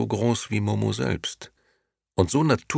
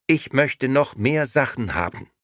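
high, squawky doll voice; a demanding little doll, high-pitched; talking doll; 8 semitones higher, 3 dB louder than usual. A narrator's reading. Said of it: "deren Lippen wieder, und sie sagte".

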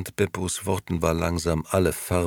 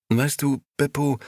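higher, lightly voiced young man, unsure; young Gigi, hesitant; 9 semitones higher than usual. Gigi cautiously opening up to his old friend, higher voice. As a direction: ease into a worried thought.

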